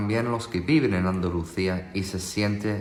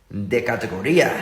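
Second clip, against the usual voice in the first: Andalusian accent. Shown as a demonstration. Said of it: "Pero en andaluz".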